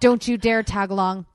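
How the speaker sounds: firm warning at a naughty pet; scolding the dog. Real, not acted.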